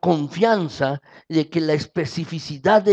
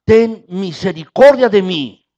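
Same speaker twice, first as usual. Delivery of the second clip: louder, higher desperate cry; shouting for mercy; 4 semitones higher, 9 dB louder than usual. Acted. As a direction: yell it out to Jesus, begging. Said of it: "Y volvió a gritar".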